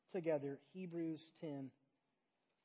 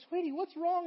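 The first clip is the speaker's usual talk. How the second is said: high, loud imitation of a mother; concerned mom; 11 semitones higher, 6 dB louder than usual. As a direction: fuss over your upset child, hammed-up. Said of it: "she's like, she's like".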